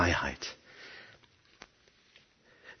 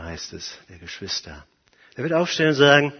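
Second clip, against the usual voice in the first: ordinary talk, nothing marked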